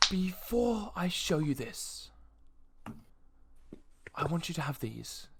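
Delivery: slow, measured noble, lifted at the start; solemn noble. Acted. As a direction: present a gift with deliberate care. Game master plays the noble.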